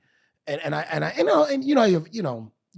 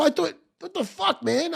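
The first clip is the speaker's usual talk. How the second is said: indignant disbelief; 13 semitones higher than usual. Retold or imitated. Outraged at Marines rejection.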